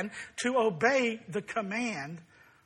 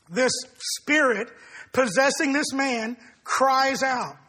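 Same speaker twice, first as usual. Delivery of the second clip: usual speaking voice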